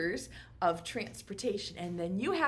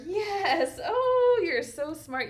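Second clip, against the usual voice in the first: a high, loud burst of delighted praise; thrilled teacher cheering a pupil; excited praise; 10 semitones higher, 8 dB louder, slower than usual. Acted. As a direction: gush over the clever answer. Praising pretend pupil's answer.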